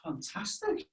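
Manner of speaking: breathless whisper. Real, not acted. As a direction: breathe the praise out. After noisy sneeze game.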